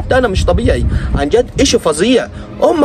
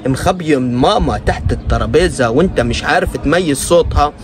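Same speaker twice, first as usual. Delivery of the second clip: ordinary delivery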